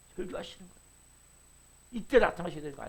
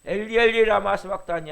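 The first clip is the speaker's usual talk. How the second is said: loud cry; 2 semitones higher, 8 dB louder than usual. Retold or imitated. Preacher crying out Jesus' words from the cross, loud. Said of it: "예수께서 크게 소리질러 이르시되".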